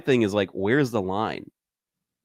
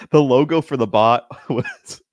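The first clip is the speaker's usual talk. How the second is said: louder, slower, laughing between words; 4 semitones higher, 6 dB louder, slower than usual. Real, not acted. Telling a friend about the bot's logo, laughing. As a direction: struggle to get it out through laughs.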